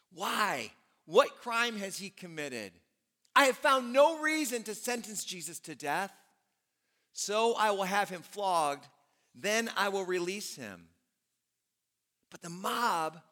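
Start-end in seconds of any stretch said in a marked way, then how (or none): 0.1-10.9 mild demanding Pilate. Acted. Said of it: "Pilate demanded".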